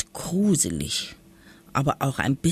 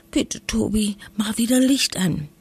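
put-on pleading little girl; 5 semitones higher than usual. A narrator's reading. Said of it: "wisperte Lisa".

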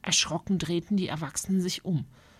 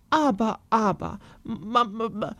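flustered, stuttering; can't get the words out; stammering mother; 4 semitones higher than usual. Acted. Mother flustered at the dinner table, stammering.

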